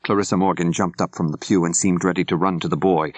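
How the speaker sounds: ordinary delivery, nothing marked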